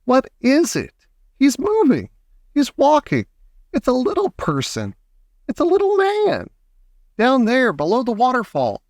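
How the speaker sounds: very high, breathless-paced exclamations; screams of excitement. A narrator's reading. Girl spotting a little man, high excited squeals. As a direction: exclaim each discovery high, short of a real scream. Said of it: "screams of excitement".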